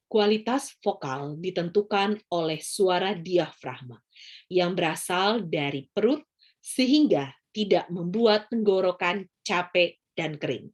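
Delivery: slow, measured projection from the belly; diaphragm voice. Shown as a demonstration. Teacher demonstrating good vocal technique to students, diaphragm voice. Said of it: "Itu adalah suara diafragma".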